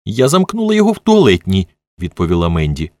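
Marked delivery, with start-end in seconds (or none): 0.0-1.7 high, upset retort; agitated self-defence; defiant outburst. A narrator's reading. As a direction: fire back in a raised pitch. Upset woman defends herself to accuser.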